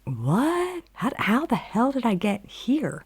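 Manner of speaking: baffled, as if just waking up somewhere strange; bewildered. Retold or imitated. Waking up on a movie set, bewildered.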